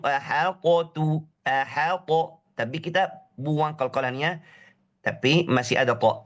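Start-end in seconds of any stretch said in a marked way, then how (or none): 0.0-2.1 "ahaqatu" with a bouncing echo on the t; wrong bouncing "ahaq"; with qalqalah. Shown as a demonstration. Teacher demonstrating the qalqalah mistake. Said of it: "Kalau pakai qalqalah seperti itu".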